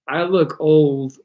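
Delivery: usual speaking voice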